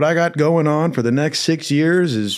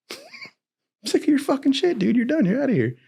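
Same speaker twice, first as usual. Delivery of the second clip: mocking stepdad; 14 semitones higher than usual. Son mimicking the stepdad's angry look, high and mocking. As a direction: sneer it back like a mocked parent. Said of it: "he gave me that look like".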